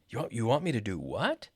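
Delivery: incredulous rise to WHAT; questioning, voice lifting on WHAT; question intonation. Shown as a demonstration. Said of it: "Using a question intonation".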